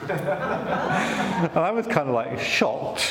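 slow with a real laugh; laughing in disbelief. Real, not acted. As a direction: laugh at how startled he was. Laughing at his own shock.

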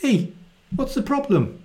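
put-on high breezy boy voice; casual boy. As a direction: shrug it off like a kid. Twelve-year-old Jesus answering back.

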